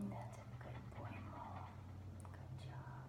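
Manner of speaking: soft, whispered calm praise. Shown as a demonstration. Trainer praising a resting dog, very soft and calm. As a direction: soothe the dog with hushed praise. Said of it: "I'm giving him very calm affection".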